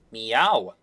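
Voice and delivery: imitation cat call; cat meow. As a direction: play the cat with one dropped-pitch meow. Acted cat answering.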